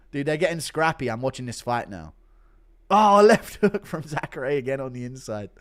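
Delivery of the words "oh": usual speaking voice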